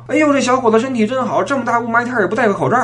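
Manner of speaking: high chatty Beijing auntie; old auntie voice. Retold or imitated. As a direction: gush like a nosy old auntie. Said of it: "老大妈说了".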